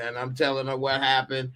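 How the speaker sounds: ordinary talk, nothing marked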